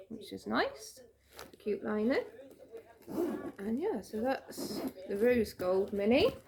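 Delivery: talking through hiccups; hiccuping between words. Real, not acted. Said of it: "Sorry, I've got the hiccups now".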